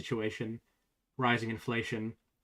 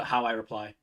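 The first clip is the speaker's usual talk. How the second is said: ordinary delivery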